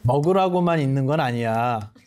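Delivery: 웃음 섞인 가벼운 꾸짖음; 천천히 웃으며 스님 말씀 흉내. Retold or imitated. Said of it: "뭐라 그러시냐면". (웃으며 나무라는 스님 말투)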